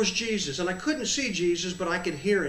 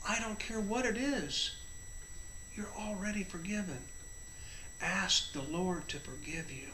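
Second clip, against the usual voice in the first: soft, deliberate, with long pauses; hushed, tender; 7 dB quieter than usual. Real, not acted. Preacher assures listeners they are forgiven, hushed. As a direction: drop to a hushed, earnest reassurance.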